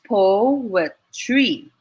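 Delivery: "poetry" drawn out in three slow beats; syllable by syllable. Shown as a demonstration. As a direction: count out "poetry" as po, e, try with pauses. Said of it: "It's three syllables as well".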